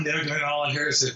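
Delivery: ordinary talk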